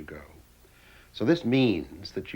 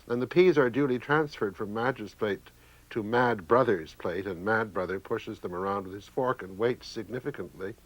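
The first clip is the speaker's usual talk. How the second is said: ordinary talk, nothing marked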